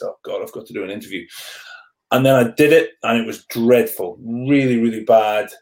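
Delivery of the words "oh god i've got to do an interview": low, quiet, hurried self-talk; dreading inner thought. Retold or imitated. Rugby player replaying his waking thought about the interview, quiet dread. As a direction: drop it to a worried aside to yourself.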